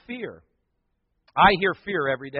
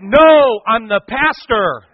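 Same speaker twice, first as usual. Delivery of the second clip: loud retort; 4 semitones higher, 12 dB louder, slower than usual. Retold or imitated. Pastor answering the screaming girl.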